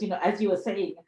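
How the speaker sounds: ordinary talk, nothing marked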